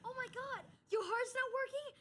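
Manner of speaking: high-pitched panicked questioning; alarmed. Acted. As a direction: react in shock, voice shooting up. Believes Jay has heart trouble.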